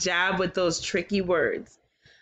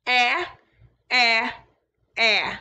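a short, louder pretend sob on "Eh"; mock crying eh; 4 dB louder, slower than usual. Shown as a demonstration. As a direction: fake a little cry on each "Eh". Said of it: "we can pretend to cry".

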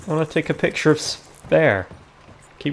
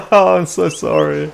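sorry said through laughter, louder; laughing apology; 4 semitones higher, 5 dB louder than usual. Real, not acted. Player apologising to the angry rider, laughing apology. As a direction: apologise while still cracking up.

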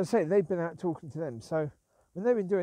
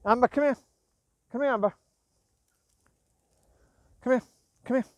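loud, higher call to a dog; 8 semitones higher, 7 dB louder, faster than usual. Real, not acted. Owner calling Amber back, loud higher call. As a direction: call her back firmly.

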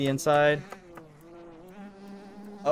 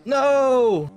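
frustrated yell; 7 semitones higher, 5 dB louder than usual. Real, not acted.

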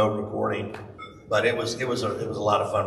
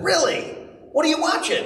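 much higher, louder, keen questioning; mock-eager dad; 4 dB louder than usual. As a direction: pitch it way up with exaggerated interest. Retold dad acting keenly interested.

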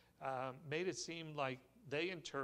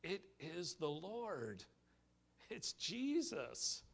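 awed declaration; 4 semitones higher, slower than usual. Retold or imitated. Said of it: "John declares".

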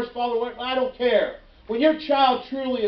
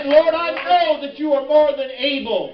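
raised, fervent prayer; preacher acting out a desperate plea; crying out to God; 4 semitones higher, 3 dB louder than usual. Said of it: "cry out to God".

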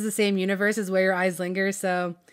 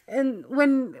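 ordinary talk, nothing marked

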